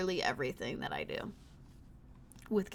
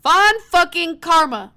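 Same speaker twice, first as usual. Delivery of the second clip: slow, loud, raised exclamation; exasperated shout; 8 semitones higher, 15 dB louder, slower than usual. Real, not acted. Player cursing a character she hates, exasperated. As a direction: spit out the name loudly, word by word.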